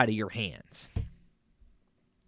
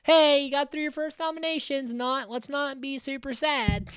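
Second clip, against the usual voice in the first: cheery show voice; 10 semitones higher than usual. Retold or imitated. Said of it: "everybody can kinda like".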